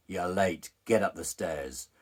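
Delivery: loud, commanding dominatrix-style order; stern master's order. Retold or imitated. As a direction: order the client about, loud and slowing down. Acted bondage master ordering client.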